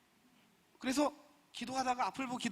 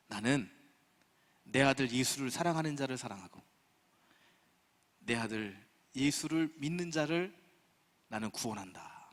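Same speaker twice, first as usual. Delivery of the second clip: voice of God; 7 semitones lower than usual. Retold or imitated. Preacher quoting God's revelation to him, in a deep grave voice. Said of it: "하는 말씀을 주셨어요".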